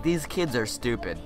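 normal talking voice

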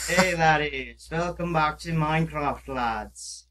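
slow, loud hammed-up Scottish greeting; a light put-on Scottish brogue; mock Scottish accent. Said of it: "that's Scottish".

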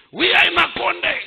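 ordinary talk

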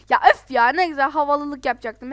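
loud groaning complaint; annoyed outburst. Real, not acted. Everyone passed thanks to her, a loud huff. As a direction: huff it out loudly.

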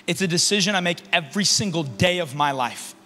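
usual speaking voice

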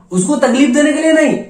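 sudden high, very loud outburst; shouted denial. Acted. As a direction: shout it in urgent self-defence. Killer shouts denial of cruel intent.

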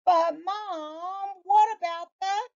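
put-on high boyish voice, cut off; high child protesting. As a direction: whine it like a kid interrupted.

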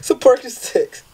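slow, laughing through the word; giggling while reading it out. Real, not acted. Reading a dealer's text, laughing.

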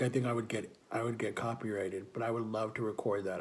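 usual speaking voice

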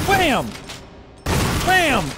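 high, loud "Bam!"; explosive high-pitched call; shouted exclamation. Real, not acted. Calling out hits in game.

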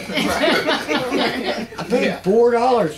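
slow, high laughing complaint. Real, not acted. Laughing as he tells the group what the broccoli cost. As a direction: laugh at the price.

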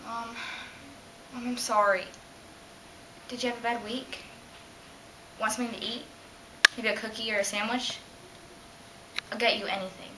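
quiet, subdued pleading; soft apology. Acted. Girl apologising to her mom, soft and pleading. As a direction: back off and coax softly. Acted apology to drunk mother.

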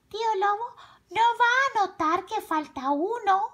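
put-on high little-girl voice; little girl's voice. A narrator's reading. Carmela reasoning about the missing fritter, high little-girl voice. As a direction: think it to yourself in a high child's voice.

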